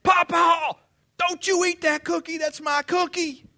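put-on a small child protecting her cookie, shouting; granddaughter shouting. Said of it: "running out of the nursery area comes Cadence".

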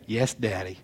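soft, low imitation of a daughter; hushed, sweetly compliant mock reply. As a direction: answer softly like an obedient child. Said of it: "And she'd say".

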